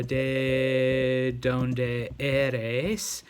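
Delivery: very slow, every Spanish syllable spelled out; slow dictation. Shown as a demonstration. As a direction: dictate it slowly for typing. Teacher dictates Spanish phrase slowly.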